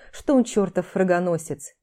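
ordinary delivery

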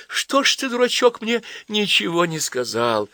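put-on girl tearfully chides the boy. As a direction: scold him tenderly through tears. Varya tearful reproach.

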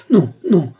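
high, loud cry of refusal; sharp cry. A narrator's reading. Marthe refusing the count's hand, crying out. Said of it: "Marthe jeta un cri".

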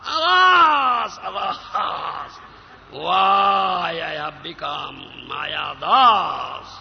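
loud cries of "Alas!" that sink into a slow wail; anguished lament. Acted. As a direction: cry out in despair, then drag out the question. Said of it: "then he says".

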